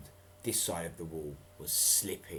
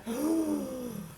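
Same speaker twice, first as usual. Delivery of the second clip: gasp. Acted. Reader gasps before Jess calls.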